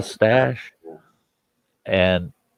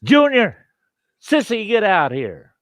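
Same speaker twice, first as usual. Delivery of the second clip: high-pitched holler out to the family; retold yell; 12 semitones higher than usual. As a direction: mimic a backwoods mother hollering. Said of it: "she yells out".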